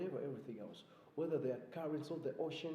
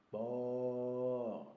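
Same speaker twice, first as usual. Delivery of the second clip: cow moo imitation; 5 dB louder than usual. Shown as a demonstration. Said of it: "That's how a cow sounds like".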